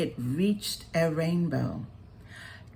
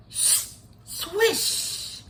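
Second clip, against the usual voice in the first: airy whispered swish of spray; whooshing swish; 13 semitones higher than usual. A narrator's reading. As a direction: breathe out the sound of water. Narrator voices water spray.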